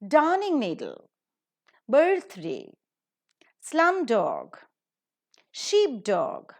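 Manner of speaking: Darning needle, birthday, slum dog, sheep dog, starts punched; stress on first syllable. Shown as a demonstration. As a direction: hit DARN, BIRTH, SLUM, SHEEP, then fall away. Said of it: "the stress is always on the first syllable".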